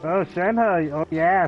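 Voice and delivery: usual speaking voice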